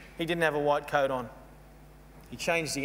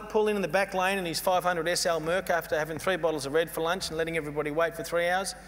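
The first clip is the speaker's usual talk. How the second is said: normal talking voice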